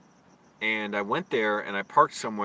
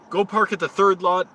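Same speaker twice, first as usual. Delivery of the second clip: retold shout; 7 semitones higher, 9 dB louder than usual. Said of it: "He was like".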